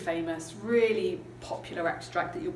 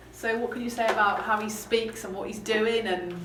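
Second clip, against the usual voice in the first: ordinary talk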